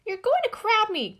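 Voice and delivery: very high, loud whiny squeak; complaining mouse. A narrator's reading. Mouse complains to Gus, high complaining squeak. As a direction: grumble it. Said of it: "complained Mouse".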